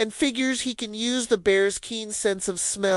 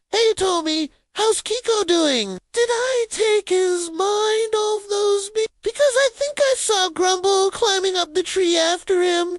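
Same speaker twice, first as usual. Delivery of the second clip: cheerful bear voice; 12 semitones higher than usual. Acted. Grumble asks Tommy.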